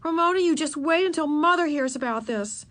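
high, scolding threat; storming. A narrator's reading. Beezus threatening Ramona with Mother, storming. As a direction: storm at a naughty little sister. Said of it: "stormed Beezus".

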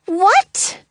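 high startled "what"; shocked cry. A narrator's reading. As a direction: blurt it out in shock. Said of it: "Goldie cries".

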